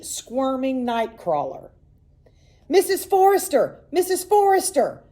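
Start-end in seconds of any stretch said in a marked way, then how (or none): 2.7-5.0 high, loud taunting shout; shouting at top of lungs. A narrator's reading. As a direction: holler for the mother to come. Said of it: "shouted at the top of their lungs".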